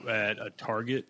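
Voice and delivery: ordinary talk, nothing marked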